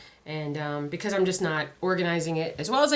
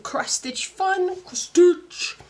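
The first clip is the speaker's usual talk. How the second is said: high, loud title call; sing-song title call; 13 semitones higher, 7 dB louder, much slower than usual. Acted. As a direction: belt out the show name.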